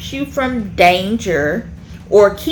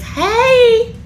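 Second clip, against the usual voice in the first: put-on a mother calling out to her kid; mom scolding; 13 semitones higher than usual. Mom catching her child not cleaning, high scolding call.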